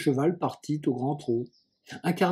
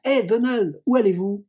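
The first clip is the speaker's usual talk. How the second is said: higher, louder hail across the road; boy hailing the farmhand from afar, calling out; 6 semitones higher, 5 dB louder than usual. Acted. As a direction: call out to the cart driver. Calling to Donald on the cart.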